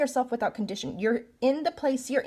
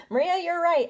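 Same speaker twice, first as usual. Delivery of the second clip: laughing, a bit higher, conceding she was right; laughing admission; 6 semitones higher than usual. Pretend. Pretend viewer tells Maria she was right, laughing. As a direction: chuckle it out, caught out.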